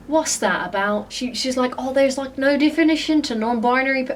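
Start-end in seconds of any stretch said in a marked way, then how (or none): 1.8-4.2 lightly put-on voice in an odd accent; mild mocking imitation in a muddled accent; brother imitation. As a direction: quote it in a slightly raised, put-on voice. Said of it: "voice I put on when I'm imitating my brother".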